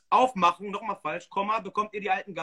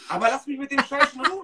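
laughing exasperation; 5 semitones higher, 5 dB louder than usual. Real, not acted.

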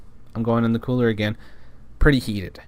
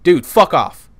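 loud, higher-pitched angry dismissal; snapping; 5 semitones higher, 8 dB louder, slower than usual. Retold or imitated. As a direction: snap at him to back off. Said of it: "I freaking snapped at him".

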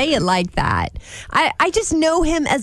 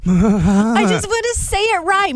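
normal talking voice

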